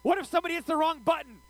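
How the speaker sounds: high, loud terrified yelling on a ride; frantic high shout of roller-coaster fear; panicked screaming. Retold or imitated. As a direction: scream it at your seatmate like you're about to drop. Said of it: "screaming at Jasmine".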